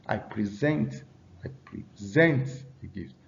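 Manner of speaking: weight on the last syllable of present; stress on second syllable. Shown as a demonstration. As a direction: present said pre-SENT. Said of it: "stress on the second syllable".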